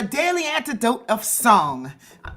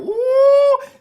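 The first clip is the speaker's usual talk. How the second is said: excited cheer; 6 dB louder than usual. Real, not acted. Host cheering a guest's arrival.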